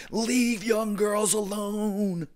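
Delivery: drawn-out, very slow threat to a creep; slow menacing warning. Retold or imitated. Wrestler scolding the beaten creep, slow menace. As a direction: lean on each word like a final warning. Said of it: "I was like".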